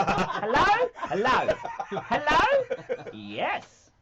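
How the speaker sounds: put-on high, loud New Zealand accent. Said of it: "New Zealand accent".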